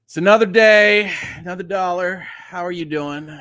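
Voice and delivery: a touch of a gloomy host; lightly voiced grumpy host. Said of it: "be like".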